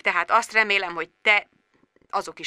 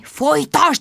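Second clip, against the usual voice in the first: hissed urging; 8 semitones higher, slower than usual. A narrator's reading. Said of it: "sziszegte Sharon".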